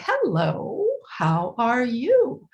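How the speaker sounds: put-on high, slow, sing-song baby talk; parentese. Shown as a demonstration. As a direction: greet a baby with swooping pitch. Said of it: "So there's a huge increase in pitch, very, um, exaggerated intonation contours".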